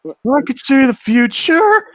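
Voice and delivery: high, loud, slow theatrical proclamation; mock dramatic. Jokingly playing a prophet about the time power-up, high loud.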